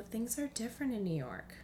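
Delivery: ordinary talk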